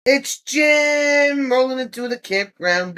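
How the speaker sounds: high, loud, slow announcement; announcing shout. Real, not acted. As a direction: call it out slowly like an arrival announcement. Announcing arrival, shout heard.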